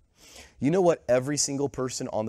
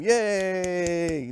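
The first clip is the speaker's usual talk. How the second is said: long drawn-out, higher yay; playful cheer; 4 semitones higher than usual. Pretend. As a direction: cheer for a game like a kids' host. Mock cheer for the game.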